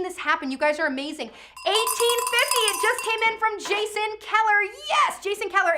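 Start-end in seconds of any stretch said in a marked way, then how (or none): 1.7-5.7 high, loud bid announcement; excited shout. Real, not acted. Auctioneer announces a sudden new bid, excited shout.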